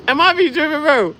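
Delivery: high, loud disbelief; squeaky loud am I tripping; exasperated. Acted. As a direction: plead in mock outrage. Comic exasperation.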